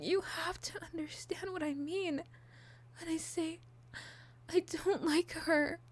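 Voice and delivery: high, intense, possessive pleading; unhinged villain. Acted. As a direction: obsessive lover insisting.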